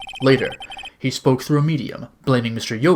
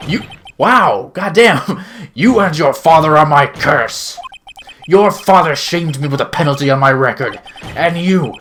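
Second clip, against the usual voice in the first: loud, building roar; furious rant; 2 semitones higher, 7 dB louder than usual. Acted. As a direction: threaten him with all you have. Villain's furious confession.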